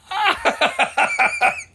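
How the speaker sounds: high, mocking laugh as the other racers. Racers laughing at the rider stuck in line, high mocking laugh. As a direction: cackle at the stuck guy.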